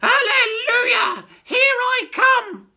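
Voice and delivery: put-on high, loud, slow screechy old woman; wild old grandma yelling as she rises; shouting old crone. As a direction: stretch it out in a gleeful screech. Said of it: "she shouted".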